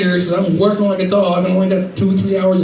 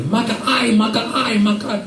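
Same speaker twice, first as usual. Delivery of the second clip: mock yelling gambler; 5 semitones higher than usual. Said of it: "kept yelling at me".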